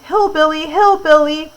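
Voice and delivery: high-pitched taunting call; mock yelling. A narrator's reading. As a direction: call it out like jeering boys, without full volume. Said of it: "they started my way yelling".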